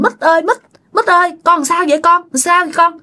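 high, urgent questioning; worried grandfather. A narrator's reading. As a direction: call to the child in alarm. Grandpa urgently questions crying child. (ông lo lắng hỏi dồn)

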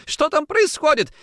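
very high, loud frightened question; alarmed cry. A narrator's reading. Wife calling from the corridor, alarmed cry. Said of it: "закричала жена из коридора".

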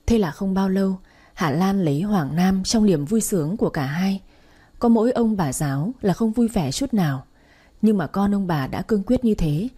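dramatic storyteller reading over music; story narration. A narrator's reading. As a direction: tell the family drama steadily.

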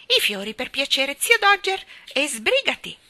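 loud, high order to hurry; very different, bossy tone. A narrator's reading. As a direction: order uncle about, impatiently. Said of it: "in tono assai diverso". (tono di comando, sbrigativo)

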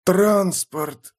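high, drawn-out word without extra volume; eerie wounded man. A narrator's reading. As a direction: force the word out. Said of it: "сказал Пауль громче".

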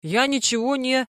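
ordinary delivery

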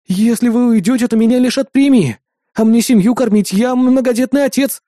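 high, put-on plaintive appeal; mock pleading. Hero stalls the fleeing gang, high-pitched mock pleading. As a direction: plead like a hard-done-by family man.

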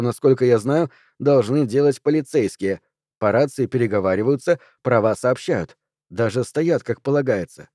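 ordinary delivery, nothing marked

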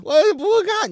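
high, loud comic protest; mock panicked refusal. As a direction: refuse like a frightened patient. 病人拒看诊断书. (夸张惊恐地高声拒绝)

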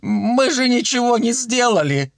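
high pitiful protest; skinny watchman chiming in. A narrator's reading. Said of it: "поддакнул тощий сторож". (жалобно, высоким голосом)

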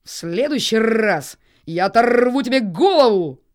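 loud crow threat with rolled R's; cawing crow. A narrator's reading. Crow chieftain threatens his captive, loud cawing. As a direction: caw a menacing warning. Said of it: "и Ветрогон прокаркал".

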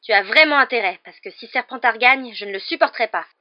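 loud, heated insistence; high, forceful and vehement; piercing voice. A narrator's reading. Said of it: "d'une voix perçante".

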